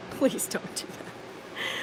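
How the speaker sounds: soft breathy laughing whisper, slow; whispered plea. Real, not acted. Pleading aside after warning men.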